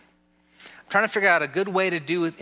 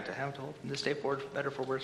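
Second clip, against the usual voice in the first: quick recap; 2 semitones lower, 10 dB quieter, faster than usual. Acted. Officiant skimming back through the vows, quick and quiet. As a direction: rattle it off softly, like finding your place. Hurried recap to find his place.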